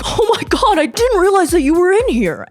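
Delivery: high mock "oops" voice; mock fake surprise. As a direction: play innocent, over the top. Dale fakes being lost.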